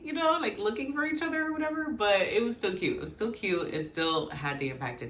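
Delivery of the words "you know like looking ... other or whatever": high, fast mock panic; mock urgency. Fan mocking the show's searching scene, high. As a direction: rush it in a squeaky voice.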